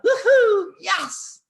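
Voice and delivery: high, loud whoop of celebration; whooping high, laughing cheer; elated yell. Retold or imitated. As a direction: cheer like a thrilled coach. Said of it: "Big Al yells out".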